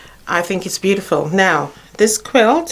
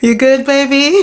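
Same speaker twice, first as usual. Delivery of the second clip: laughing; 6 semitones higher than usual. Real, not acted. Laughing fuss over the dog.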